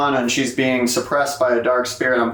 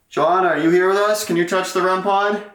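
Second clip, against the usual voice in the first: higher-pitched questioning call, rising at the ends; calling to a spirit; 8 semitones higher than usual. Real, not acted. Investigator asking Johanna's spirit to respond, raised questioning call. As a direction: call out a question to an unseen presence.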